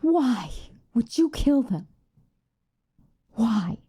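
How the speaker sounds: high-pitched, grieving accusation; wounded, outraged outburst; anguished demand. Acted. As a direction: demand an answer from the killer. Confronting the family's killer.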